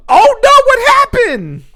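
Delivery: very high, loud mock alarm; shocked gym teacher. Imitating gym teacher seeing broken backboard, mock shock. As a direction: cry out in exaggerated dismay.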